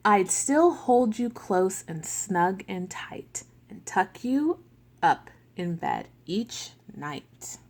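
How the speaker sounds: slow, gentle storybook read; picture-book reading with voiced characters; reading to children. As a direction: read it like a bedtime book for little kids.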